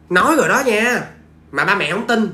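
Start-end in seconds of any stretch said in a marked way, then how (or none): none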